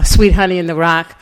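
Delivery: ordinary talk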